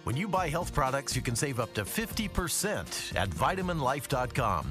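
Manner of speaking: ordinary talk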